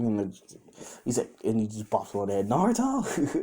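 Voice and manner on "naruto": name called much higher, like a teacher's warning; scolding call. Retold or imitated. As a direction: sing out his name in mock reproach. Teacher scolds Naruto with a bop.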